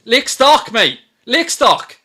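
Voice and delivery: scornful "Lick stock" with a short i; mocking misspelling. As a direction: throw "Lick stock" back at the troll, mocking. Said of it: "troll that can't fucking spell".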